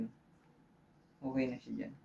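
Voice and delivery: ordinary talk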